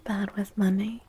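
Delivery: ordinary delivery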